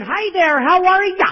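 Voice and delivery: high, loud, sing-song Midwestern greeting; mock Midwestern accent. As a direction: overdo a chirpy Midwestern accent. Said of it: "that Midwestern".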